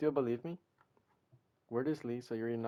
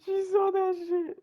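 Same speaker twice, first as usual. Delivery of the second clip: horrified disbelief; 3 dB louder than usual. Real, not acted. Caught by Sayori.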